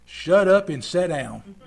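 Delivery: loud, slow, forceful order; blunt rebuke. Acted. Preacher giving Jesus' rebuke in modern vernacular, loud stern order. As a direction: lay down the law at full voice. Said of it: "you know what he said?".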